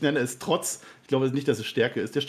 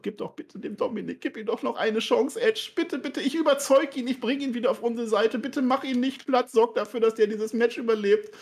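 much higher, begging voice of a worried dad; mock pleading father; 11 semitones higher than usual. Host voicing Ray begging Edge to spare Dominik, high mock plea.

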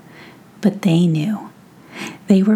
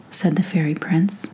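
ordinary delivery

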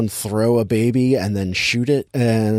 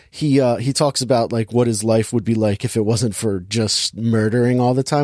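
ordinary talk, nothing marked

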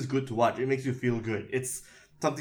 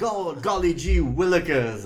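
put-on higher, louder golly-gee kid voice; wholesome 1950s kid; 6 semitones higher, 5 dB louder than usual. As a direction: play an old-fashioned wholesome kid. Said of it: "everyone is like".

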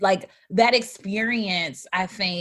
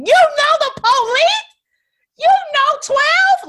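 incredulous outburst; 9 dB louder, slower than usual. Retold or imitated. Incredulous retort after the police stop.

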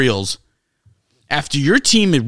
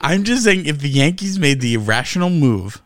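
usual speaking voice

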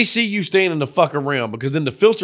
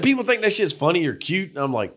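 usual speaking voice

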